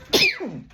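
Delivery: sudden high sneeze. Real, not acted. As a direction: let out a real sneeze. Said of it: "I have to sneeze".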